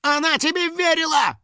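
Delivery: very high, squealing indignation; angry outcry. A narrator's reading. House spirit echoing the girl's accusation, angry. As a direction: shriek the accusation on her behalf. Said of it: "также гневно воскликнул Финик".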